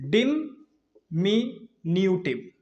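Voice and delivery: "डिमिन्यूटिव" very slow, split as di-mi-nyu-tiv; syllable by syllable. Shown as a demonstration. Teacher introducing a new word, "डिमिन्यूटिव" slowly syllable by syllable. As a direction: draw out each piece of "डिमिन्यूटिव" with gaps.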